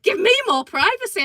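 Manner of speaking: high, loud scandalised repeat; incredulous echo. Retold or imitated. Woman echoing the neighbour's privacy excuse, incredulous. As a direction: throw the excuse back in disbelief.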